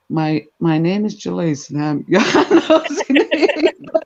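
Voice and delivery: slow mock introduction dissolving into giggles; breaking into laughter. Nosy neighbour introducing herself as a joke, breaking into laughter. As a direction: start straight, then crack up laughing.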